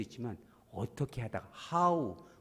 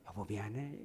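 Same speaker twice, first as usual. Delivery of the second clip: soft apology; 6 semitones lower, 11 dB quieter than usual. Retold or imitated. Modeling apology to spouse.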